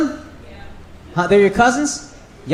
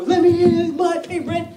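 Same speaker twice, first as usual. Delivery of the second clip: high-pitched mock demand through a door; mock door plea; 7 semitones higher than usual. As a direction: act out begging to be let in. Acts out pleading at locked door.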